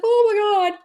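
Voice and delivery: high, loud mock scream of glee; imitated excited squeal. Host imitating a guest kicking and screaming, excited squeal. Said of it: "She was kicking and screaming, not, not in a bad way".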